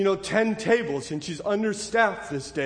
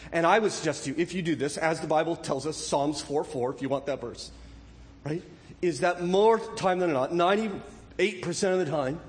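normal talking voice

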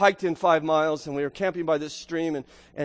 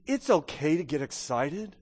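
ordinary talk

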